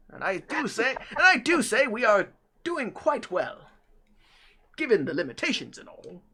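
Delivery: chatty British gentleman, hesitating as he explains; British robot butler. Acted. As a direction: play a proper British robot mechanic. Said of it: "have a British accent most of the time".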